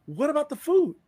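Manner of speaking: high, loud mock disbelief; incredulous question. Acted challenge over missing food.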